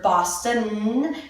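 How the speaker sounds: BOSTON slowed, its last N drawn out; held final N. Shown as a demonstration. Teacher practicing the tongue tip, BOSTON with lingering N. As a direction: let the N ending BOSTON hum on. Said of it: "how to practice that tip".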